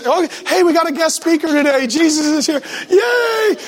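high, hammed-up welcome; mock cheering congregation. Congregation welcoming a guest speaker, mock excitement. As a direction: build to a squealed cheer.

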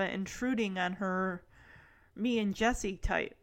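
ordinary talk

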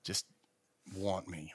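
soft, tempting whisper; whispering bike. Retold or imitated. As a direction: lure him in a hush. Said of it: "that bike whispering to me".

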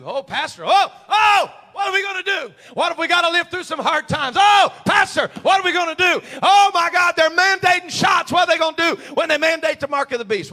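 high, loud, hammed-up panicked wail; mock panic. Congregant to pastor about hard times, mock panicked shouting. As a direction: mimic a frantic churchgoer crying out. Acting out panicked church members.

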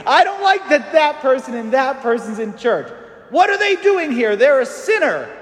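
high, mock-scandalised churchgoer; mock indignation. Grumbling churchgoer scandalised by sinners in church, mock outrage. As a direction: ham up a self-righteous complaint.

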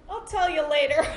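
high, loud, laughing aside; laughing, retold flustered brush-off; laughing put-off. Spooked, putting partner off while laughing.

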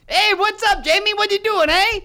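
put-on loud, high-pitched botched New York accent; bad New York accent. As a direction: holler a hopeless attempt at New York. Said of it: "Give me a New York accent".

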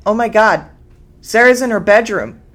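panicked thought. A narrator's reading. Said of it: "she thought".

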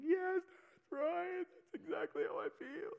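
high, slow tearful voice, imitating her; retold through tears, high and drawn out; crying. Woman recalling her mother, imitated crying. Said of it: "She starts crying".

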